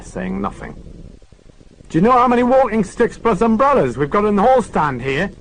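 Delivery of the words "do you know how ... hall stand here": loud, high call from the hall; shouting. Acted. Lewis shouting from the tiny entrance to Morse. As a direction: call out across the flat, much higher and louder. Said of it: "shouted Lewis".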